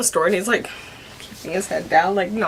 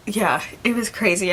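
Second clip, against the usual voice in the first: ordinary delivery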